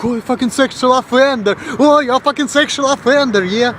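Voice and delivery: high-pitched imitation of an offended woman; mock outrage. Offended woman accusing him after his comeback, high mock outrage. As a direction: squeal the accusation in mockery.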